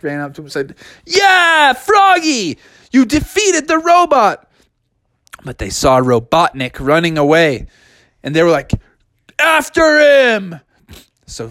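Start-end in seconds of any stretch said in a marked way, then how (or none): 1.0-4.5 higher, loud victory cheer. Acted. Sonic cheers the win. 9.4-10.6 higher, loud, slow call; rallying shout. Acted. Robotnik runs away.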